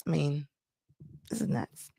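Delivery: a stunned whispered aside; hushed, disbelieving whisper. Real, not acted. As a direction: breathe it out under your breath. Disbelieving aside after long pause.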